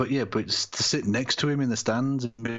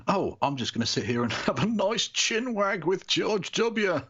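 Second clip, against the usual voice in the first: mocking Ellen; 8 semitones higher than usual. Mocking Ellen chatting with Bush.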